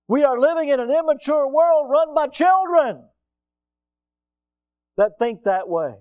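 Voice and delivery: loud, high pulpit cry; forceful preaching. Real, not acted. Preacher rebuking a world run by children, forceful. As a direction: drive it out at full preaching force.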